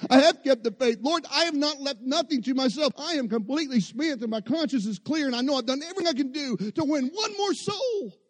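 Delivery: high, loud, fervent preaching; preaching at full force. Acted. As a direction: build the declaration to a cry. Preaching climax.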